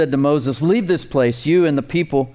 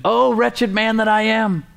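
anguished cry; 8 semitones higher than usual. Acted. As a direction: let the man break under his failure. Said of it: "he comes to the conclusion".

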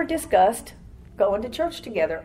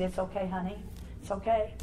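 much softer, gentle comfort; soothing; 2 semitones lower, 12 dB quieter than usual. Retold or imitated. Greeter comforts a crying newcomer, soft and tender. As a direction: hush her like a crying child.

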